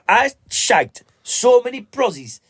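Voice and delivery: high, slow Geordie voice; Geordie accent. Retold or imitated. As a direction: drawl it out like a Geordie lad. Imitating the lottery winner.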